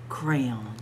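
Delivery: plain reading voice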